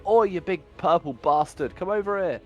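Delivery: loud, high call to provoke a foe; taunting shout. Pretend. Archer shouts at a monster to draw its charge, taunting shout. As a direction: holler a challenge across the battlefield. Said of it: "Can I just shout at it".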